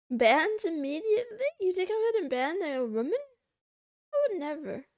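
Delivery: mock outrage. Streamer protesting to chat that she would never ban a woman, mock outrage. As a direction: act scandalised at the suggestion.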